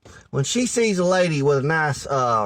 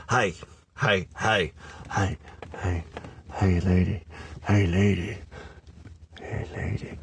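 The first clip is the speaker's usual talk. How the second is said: lower, fading creepy "hey, lady" calls; creepy catcall; 8 semitones lower, 6 dB quieter than usual. Retold or imitated. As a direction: creep on her, trailing to a hiss. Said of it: "That's creepy".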